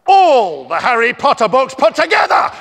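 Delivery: loud, high exultant shout. Acted. Author shouting a fan letter's praise to the kids. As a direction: crow the praise out.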